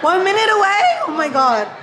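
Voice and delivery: high, loud surprised yelp; surprised shriek. Real, not acted. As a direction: gasp it out shocked. Reacting to time left.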